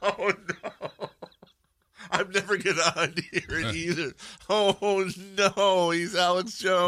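high, laughing disbelief; laughing dismay. Real, not acted. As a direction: crack up in mock horror, voice climbing. Cracking up at the voice likeness.

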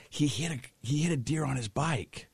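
mildly panicked retelling; distressed bystander. Retold or imitated. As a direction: imitate a shaken witness, keeping the volume down. Acts lady explaining crash.

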